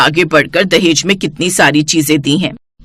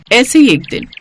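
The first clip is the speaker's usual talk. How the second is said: ordinary delivery, nothing marked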